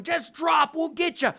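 high, loud call from below; shouting up. Acted. Boat crew shouts up to the man on the cliff, loud and high.

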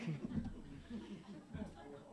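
quiet, breathy laugh; real amused laugh; soft laugh. Real, not acted. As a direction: chuckle at the absurdity. Laughing at mom's Uber story.

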